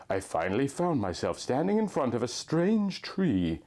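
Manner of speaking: plain reading voice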